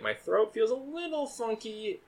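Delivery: imitating his sick self. Telling his cohost about the festival sniffles, mock sick voice. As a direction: play up the funky throat with a mock voice. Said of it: "I was like".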